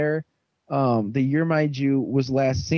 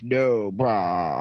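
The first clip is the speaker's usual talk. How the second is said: retold bro refusal; 6 semitones lower than usual. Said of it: "And he's like".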